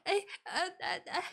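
halting little stutters of panic; flustered stammering. Retold or imitated. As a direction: act out being lost for words. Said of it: "然后我就开始支吾".

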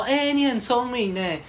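high, slow, laughing compliment; mock flattering saleswoman. Saleswoman teasingly praises him as clever, high laughing drawl. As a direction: tease him like a sly saleswoman.

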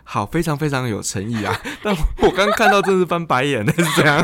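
talking through laughter; laughing. Real, not acted. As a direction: laugh while teasing back. Guest laughs at host's teasing.

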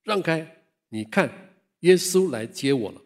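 put-on dying man shouting to the old woman, loud joyful yell. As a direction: cry it out gladly. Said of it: "就这样一直大喊".

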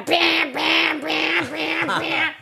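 loud high repeated blare; phone alarm imitation. Host imitating the phone's quake warning, loud high blaring. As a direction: blare it like an earthquake alert. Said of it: "手机的那".

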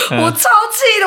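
high strained laugh. Real, not acted. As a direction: laugh out the frustration. Laughing angrily.

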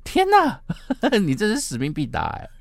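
amazed friend. Retold or imitated. Friend marvels at her taxi trip, squealing amazed. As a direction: gasp it out in delighted amazement. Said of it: "朋友就讲说".

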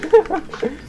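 short high-pitched laugh. Real, not acted. Host laughs at being called an idiot, giggling. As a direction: burst out in a quick high giggle.

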